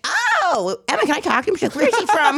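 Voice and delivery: high, scolding mom voice; hammed-up mother grilling her daughter; mock mom. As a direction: mom asks Emma about the guest, voice pitched high. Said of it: "and she'd be like".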